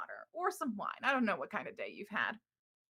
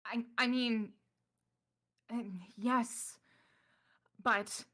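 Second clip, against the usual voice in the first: Ystera flustered; 3 semitones higher, slower than usual. Acted. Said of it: "Ystera says".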